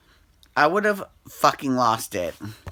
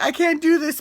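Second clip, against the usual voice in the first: high mock whine, much higher; mock scared; 14 semitones higher, 4 dB louder than usual. Mocking Scott at the bungee edge, high mock whine. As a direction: whimper it like a coward. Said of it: "he was like".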